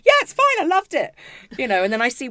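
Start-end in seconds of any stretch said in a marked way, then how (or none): 0.1-1.2 high, loud, falsely breezy; mock cheerful dad. Mimicking the father pretending the trip was great, bright fake cheer. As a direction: ham up the dad's fake cheer. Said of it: "his dad would walk in and go".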